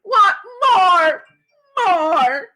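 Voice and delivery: high, loud crying wail; crying toddler. Acted. Fudge to his father over the thrown-out omelet, crying. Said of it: "Fudge started to cry".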